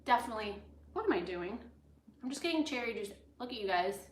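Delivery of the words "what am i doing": ordinary talk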